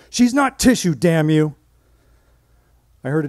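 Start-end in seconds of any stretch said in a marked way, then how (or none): 0.1-1.5 high, loud enraged yell; furious shout. Retold or imitated. Grieving husband yelling at the interviewer, high and loud. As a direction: explode at the caller. Grieving widower explodes at caller.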